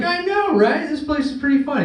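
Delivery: high, eager squeal of agreement; excited agreement. Retold or imitated. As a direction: gush like an overexcited kid. His own excited reply.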